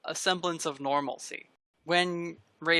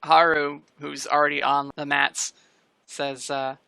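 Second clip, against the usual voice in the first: ordinary talk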